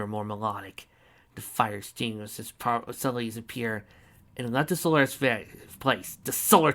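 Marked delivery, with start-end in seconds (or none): none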